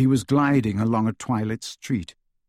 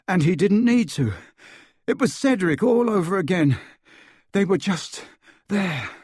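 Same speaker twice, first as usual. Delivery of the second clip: raised, upset recounting; higher-pitched, shaken by what was seen; distressed; 7 semitones higher, 3 dB louder than usual. A narrator's reading. Harry telling friends of a murder he saw, upset.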